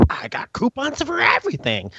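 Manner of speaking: imitating the coupon lady in line; mock coupon lady. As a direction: play the proud coupon lady. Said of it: "It's like".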